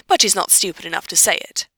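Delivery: ordinary delivery, nothing marked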